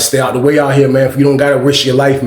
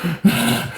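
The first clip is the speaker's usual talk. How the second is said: breath taken between screams; imitated gasp for breath; 6 dB quieter than usual. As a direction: heave a mock recovering breath. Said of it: "he had to take a breath from screaming".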